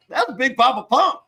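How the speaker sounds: high, put-on judge voice; incredulous mock TV judge; mock Steve Harvey.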